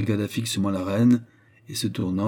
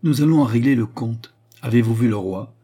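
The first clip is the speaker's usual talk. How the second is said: louder, imperious disdain; crushing contempt; 3 semitones higher, 8 dB louder than usual. A narrator's reading. Queen settles accounts with Jeanne, crushingly contemptuous. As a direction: cut her down from on high. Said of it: "plus écrasante encore du mépris".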